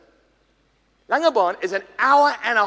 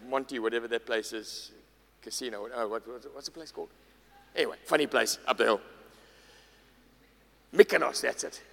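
muttered aside; 7 semitones lower, 6 dB quieter than usual. Real, not acted. Aside searching for name.

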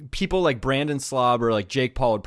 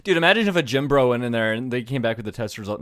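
usual speaking voice

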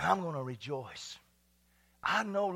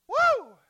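short, high, loud "woo"; exultant whoop; 13 dB louder than usual. Real, not acted. Pastor overcome mid-sermon, exultant whoop. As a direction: whoop loudly in praise. Exultant cry while preaching.

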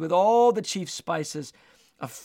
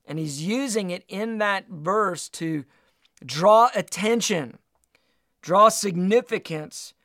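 ordinary delivery

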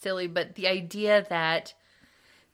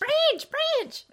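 "Branch, branch" squeaked out much higher, playful; mock squeaky echo. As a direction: parrot "Branch, branch" in a silly high voice. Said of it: "Cranch, cranch".

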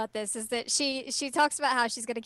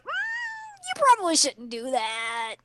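high, drawn-out reproach; hammed-up slow tut-tutting; mock disapproval; 6 semitones higher, slower than usual. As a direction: hum doubtfully, then stretch out a high-pitched warning. Acted disapproving reaction to Myoga perving.

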